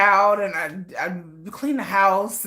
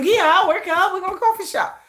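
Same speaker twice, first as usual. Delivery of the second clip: high bubbly plans; 12 semitones higher, 3 dB louder than usual. Retold or imitated. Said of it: "that's how my mind works".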